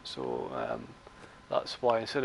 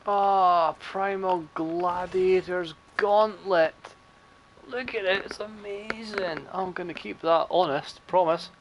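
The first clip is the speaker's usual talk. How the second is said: higher-pitched mock delight, drawn out; sarcastic; 5 semitones higher, slower than usual. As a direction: gush with fake amazement, dripping sarcasm. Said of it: "You can't tell the sarcasm in my voice".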